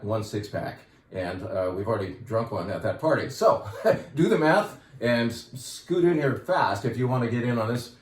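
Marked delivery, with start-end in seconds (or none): 3.3-6.8 slow, laughing through the words; amused slow push, grinning. Real, not acted. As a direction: chuckle while urging them to hurry. Joking about limited stock.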